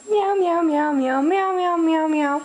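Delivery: high, loud meowing like a cat; nervous cat voice. Acted. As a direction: meow up high to amuse the baby. Repeated meowing to amuse baby.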